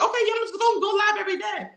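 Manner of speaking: high, quick carefree promise; naive promise voice. Retold or imitated. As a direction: toss it off like it's easy.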